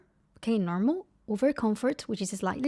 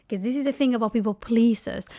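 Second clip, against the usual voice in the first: usual speaking voice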